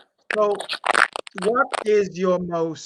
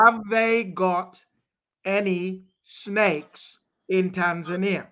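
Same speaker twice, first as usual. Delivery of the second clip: very slow, each word set apart; slow, careful repeat; slower than usual. Real, not acted. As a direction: spell it out slowly for someone who can't hear. Repeats for listener who can't hear.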